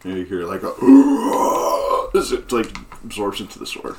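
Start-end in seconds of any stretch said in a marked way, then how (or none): 0.7-2.1 loud high gasp; sharp high gasp. Retold or imitated. Sword absorbs soul sound.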